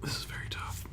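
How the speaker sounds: unvoiced hushed whisper. Real, not acted. Podcast friend agonizes over which card to pick, whispering. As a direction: whisper it as if deciding a secret.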